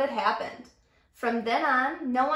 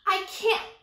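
high, reluctant protest; 7 semitones higher than usual. Acted. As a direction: start to refuse. Rainbow fish protesting.

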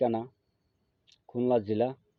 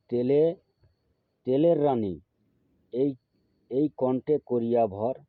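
slow, raised-voice mantra recitation for learners to memorise; slow mantra demo. Shown as a demonstration. Guru teaching viewers a mantra to memorise, slowly and deliberately. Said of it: "আস্তে আস্তে বলবো".

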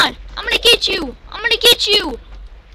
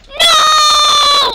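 long, high, loud "no!"; drawn-out high wail; defeated scream; 7 semitones higher, 5 dB louder than usual. Real, not acted. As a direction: cry out at losing. Losing as countdown ends.

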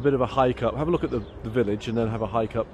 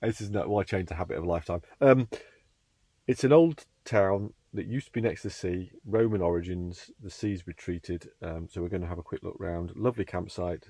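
hushed; 6 dB quieter than usual. Real, not acted. Speaks hushed in the early morning.

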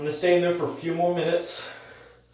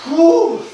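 loud cold exhale; 11 semitones higher, 9 dB louder than usual. Real, not acted. In ice bath.

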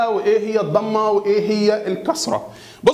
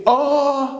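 drawn-out groaned "ah"; acted, long "aah" of pain; mock cry of pain; 4 semitones higher, much slower than usual. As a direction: moan like someone slapped on the neck, demonstrating. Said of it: "هيقوم قايل إيه؟".

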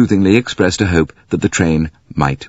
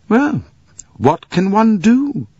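Mary Debenham voice; slower than usual. Acted.

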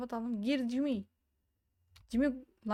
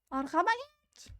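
much higher, drawn-out urgent cry; frantic order; 11 semitones higher, 3 dB louder, slower than usual. Real, not acted. Player urging a companion to get behind, frantic. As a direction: order them behind you, voice shooting up.

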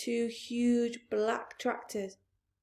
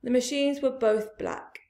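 ordinary delivery, nothing marked

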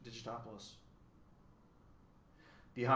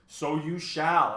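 slow, louder proclamation; announcing dodecahedron; 5 semitones higher, 9 dB louder, much slower than usual. A narrator's reading. Dodecahedron brings Milo's gifts, slow grand announcement.

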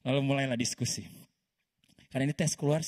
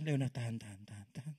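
bisik menahan; 4 semitones lower, 7 dB quieter than usual. Retold or imitated. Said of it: "Teman-teman sampai depan".

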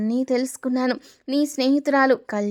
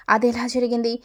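usual speaking voice

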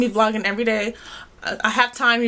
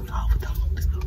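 hushed unvoiced whisper; 3 dB quieter, slower than usual. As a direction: whisper it so nobody hears. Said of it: "Why, why you whispering?".

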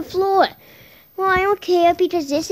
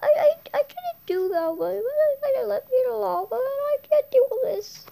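much higher whimpering small voice; high teary child voice, laughing through tears; crying little character; 9 semitones higher than usual. Acted. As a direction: sob it out like a tiny scared kid. Tiny character crying again.

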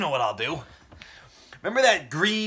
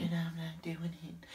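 whisper; 5 semitones lower, 16 dB quieter than usual. Real, not acted. Muttered refusal, whispered.

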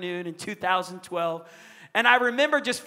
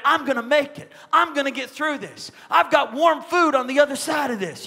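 higher, emphatic retold declaration; declaring; 5 semitones higher, 4 dB louder than usual. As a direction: proclaim it like a boy rallying himself. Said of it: "He starts declaring".

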